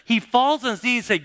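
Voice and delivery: usual speaking voice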